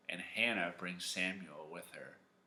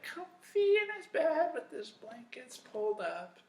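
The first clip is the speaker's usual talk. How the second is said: very high, snuggly put-on voice; cozy little squeal. Storyteller tucking young Samuel into bed, squeaky cozy voice. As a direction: squeak it like snuggling under blankets.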